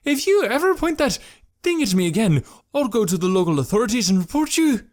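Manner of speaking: high, loud threatening shout. Acted. Jekrom threatens Malik, shouting. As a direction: yell the threat at him. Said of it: "Now Jekrom was shouting".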